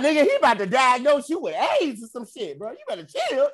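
high laughing scold; laughing warning. Retold or imitated. Friend joking to the doctor, warned off while laughing. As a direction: warn him off while cracking up.

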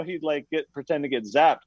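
usual speaking voice

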